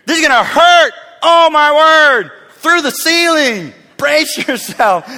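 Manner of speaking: high, loud mock panic; hammed-up shouting, laugh breaking in; comic alarmed shout. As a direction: yell the warning over the top. Honest version of doctor's warning, yelled.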